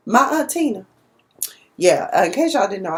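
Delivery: normal talking voice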